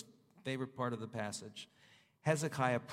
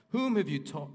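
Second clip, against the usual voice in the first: loud rebuke; 5 semitones higher, 6 dB louder than usual. Acted. God rebuking the Assyrian king.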